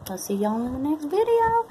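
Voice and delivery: southern accent. Real, not acted. Signing off to viewers in a southern accent. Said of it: "talking with a southern accent".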